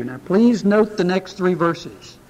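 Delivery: ordinary talk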